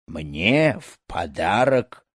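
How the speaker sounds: slow, incredulous outrage, held back in volume; angry wail. A narrator's reading. Rich snake charmer offended by a cheap gift, angry. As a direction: drag out each word in indignant disbelief. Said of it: "завопил злой Вайдван".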